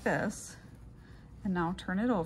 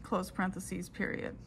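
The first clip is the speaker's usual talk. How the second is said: dictating. Shown as a demonstration. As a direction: dictate it clearly into the recorder. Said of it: "I will go ahead and do those steps in a dictation format".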